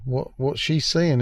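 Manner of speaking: ordinary delivery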